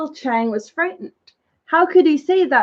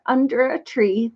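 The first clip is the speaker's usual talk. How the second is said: usual speaking voice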